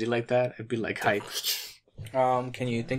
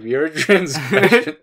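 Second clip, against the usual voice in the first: laughing mock quote; 4 semitones higher, 8 dB louder than usual. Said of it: "We're like".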